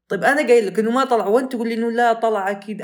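loud, high, fast retold outburst at the doctor; angry retort. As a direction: fire it back at him fast and loud. (رد غاضب بصوت عالٍ وسريع)

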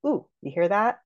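ordinary talk, nothing marked

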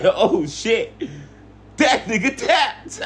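high, slow laughing disbelief; shocked laughing. Retold or imitated. Retelling shocked reaction, laughing.

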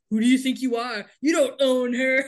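loud, hammed-up confrontation; mock indignation. Acts Jess confronting his brother.

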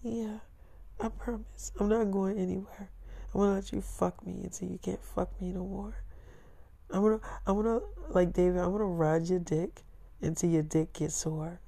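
put-on high, soft girlfriend voice; voicing his girlfriend. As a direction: play her sweet and hushed. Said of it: "She's like".

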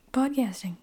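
ordinary talk

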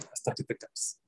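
breathy whisper for the fall; sermon whisper: until you fall. Real, not acted. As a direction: drop to a whisper at the end. Tempter drags you down.

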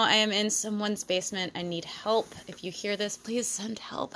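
hushed pretend plea for help, fading to a whisper; mock hostage plea. Host secretly begs listeners to rescue her, hushed mock plea. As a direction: sink into a secretive whisper, playing captive.

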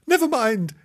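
put-on high, loud child's reassurance; consoling girl. A narrator's reading. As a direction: chirp comfort to the puppy. Imogen consoles the puppy.

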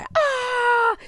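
high, sustained "aah"; re-enacted own scream. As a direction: join in with a long high yell. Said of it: "voy a gritar".

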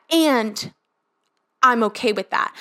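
ordinary talk

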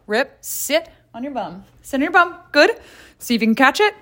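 high-pitched dog commands; talking to a dog. Real, not acted. Throwing the ball to the dog, high pet-owner voice.

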